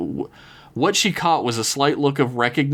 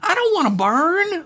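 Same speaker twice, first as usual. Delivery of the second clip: frightened scream; 12 semitones higher, slower than usual. Acted. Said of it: "Lizzie screamed out".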